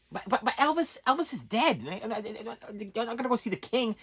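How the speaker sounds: high, stammering put-on voice; hammed-up wife in flustered disbelief; mock protesting wife. Wife tells husband [name] is dead, hammed-up high stammer.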